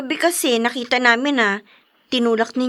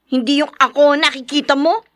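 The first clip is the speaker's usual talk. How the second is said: ordinary talk, nothing marked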